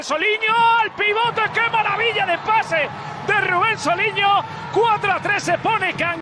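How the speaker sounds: rising, excited goal call; climbing, thrilled commentary. Real, not acted.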